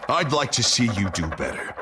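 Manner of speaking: ordinary talk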